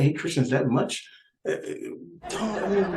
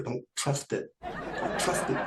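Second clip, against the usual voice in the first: whispered warning; 2 semitones higher, 8 dB quieter, slower than usual. Acted. Conspiratorial warning to audience.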